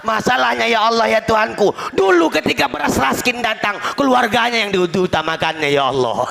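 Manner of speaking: high-pitched grievance to God; complaining villager. Acted. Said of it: "masyarakat komplain sama Allah". (mengadu dengan suara meninggi)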